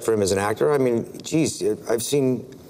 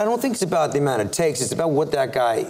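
usual speaking voice

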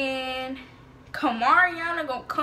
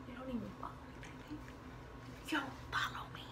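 whispery, much softer aside; hushed aside; 18 dB quieter, faster than usual. Real, not acted. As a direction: drop it to a near whisper. Muttered aside amid retelling.

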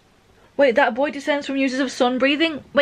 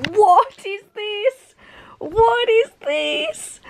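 high, slow, incredulous what is this; drawn-out shocked question, pitch way up; stunned disbelief; 11 semitones higher, much slower than usual. Real, not acted. Reader shocked by a manga reveal, high slow disbelief.

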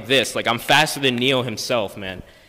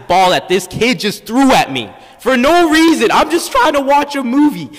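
high, loud indignant rant; outraged; 9 semitones higher, 6 dB louder than usual. Real, not acted. Teen tells the audience about the ball throw, high and loud. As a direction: vent the unfairness, voice cracking up high.

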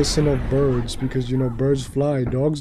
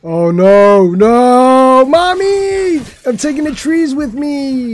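loud rising wail, climbing very high; mock panic; 10 semitones higher, 8 dB louder, much slower than usual. Mock wail as plane crashes.

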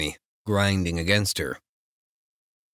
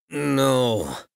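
groaned; 7 semitones higher, 4 dB louder than usual. Acted. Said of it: "I groan".

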